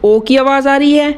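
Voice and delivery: ordinary delivery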